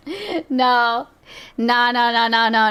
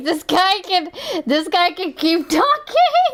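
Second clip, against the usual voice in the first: higher voice breaking into a big laugh; giggly delight; 6 semitones higher than usual. Real, not acted. Reacting to a speaker's accent that they like, giggly delight. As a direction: giggle through it, pitched up.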